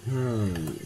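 ordinary talk, nothing marked

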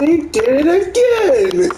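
high, loud, slow put-on whine; imitating opponent. Opponent whines that he did it again, high and slow. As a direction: drag it out as a sore loser. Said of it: "He said".

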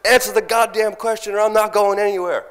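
high, loud defiant demand; retold defiant yell. Refusing to give up the microphone, loud defiant yell. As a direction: plant your feet and yell it.

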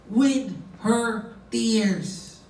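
"with her tears" slow, each word stressed and set apart; emphatic. Shown as a demonstration. Preacher showing the Greek emphasis, "with her tears" slow and weighted. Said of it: "that was emphasis".

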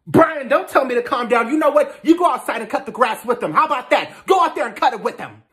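high, loud, heated tirade; angry yelling. Acted. Stepmother snapping at stepson who said calm down, angry yelling. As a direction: yell back, pitch way up. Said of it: "Karen was just yelling at me and Brian".

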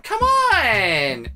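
mock her fake innocence; mocking Vanessa. Commentator mocks Vanessa.

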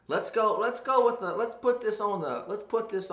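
rally everyone into the challenge; hyped "let's go" to the viewers; excited hype. Real, not acted. Hyping up the challenge.

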